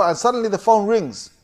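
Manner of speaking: normal talking voice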